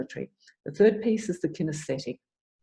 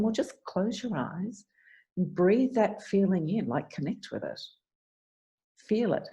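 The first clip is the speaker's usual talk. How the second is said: guided visualization; 2 semitones higher than usual. Shown as a demonstration. Coach demos guiding client inward.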